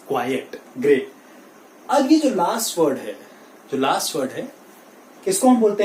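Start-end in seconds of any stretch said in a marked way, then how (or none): 0.1-0.6 "quiet" as KWAI-ut, low and soft; two syllables. Shown as a demonstration. Teacher repeats the correction, two syllables. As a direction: add the second vowel in "quiet". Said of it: "it is quiet".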